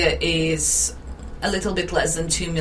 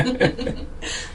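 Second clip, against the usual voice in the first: airy, unvoiced little laugh; breathy laugh; 6 semitones higher than usual. Real, not acted. Guest laughs about her accent.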